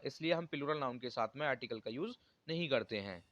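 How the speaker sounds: ordinary delivery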